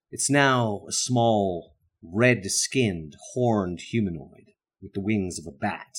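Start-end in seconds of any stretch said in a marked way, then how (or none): none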